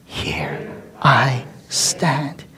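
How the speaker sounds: "Here I stand" whispered very slowly; hushed whispered "Here I stand"; whispered echo. Shown as a demonstration. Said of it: "Can you say that with me?".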